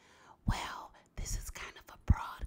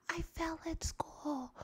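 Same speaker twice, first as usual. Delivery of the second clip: mock crying; 9 semitones higher, slower than usual. Reading a child's question.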